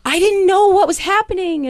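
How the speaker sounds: very high, loud mock wail; retold frantic protest. Crying employee answering the boss, mock frantic. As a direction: wail it high, reenacting helpless confusion.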